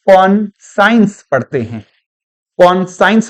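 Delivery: कॉन्साइंस as "kon-science"; Indian-style pronunciation. Shown as a demonstration. Teacher shows how Indians read it, कॉन्साइंस as kon-science. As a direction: say कॉन्साइंस with science in the second half. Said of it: "भारतीय मूल के लोग".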